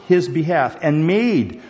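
made drawn out and leaned on hard; stress on made. Shown as a demonstration. Preacher stressing made to the congregation. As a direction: lean long and heavy on made. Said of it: "emphasize the word made".

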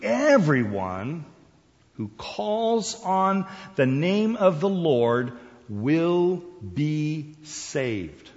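"Everyone" stretched out slowly, then each word held; slow, weighty declaration, lingering on "Everyone"; emphatic reading. Shown as a demonstration. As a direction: draw out "Everyone" and "will be saved". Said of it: "we don't get the punch".

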